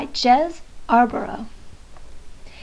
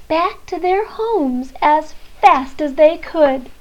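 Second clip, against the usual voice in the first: ordinary delivery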